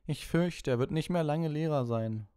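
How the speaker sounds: high, put-on mock sorrow; feigned regret. A narrator's reading. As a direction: pretend to be sorry, in a high schoolboy voice. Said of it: "mit gespieltem Bedauern".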